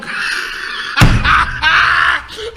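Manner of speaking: high, loud burst of laughter; loud mocking laugh. Real, not acted. Laughs at the vaccine headline.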